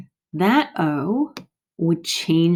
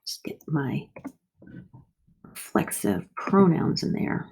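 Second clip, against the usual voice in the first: whispered aside; 5 dB quieter than usual. Real, not acted. Aside while writing.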